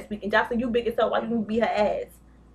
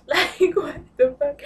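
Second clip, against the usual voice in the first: laughing disbelief; 5 semitones higher, 5 dB louder, slower than usual. Real, not acted.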